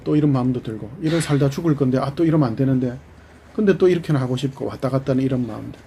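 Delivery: ordinary delivery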